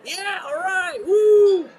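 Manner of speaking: high, loud put-on yeah all right woo; player's mock cheer. Player answering the heckling fan, high and loud. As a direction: ham it up with a squeaky cheer. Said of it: "he was like".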